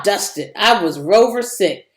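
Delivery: ordinary delivery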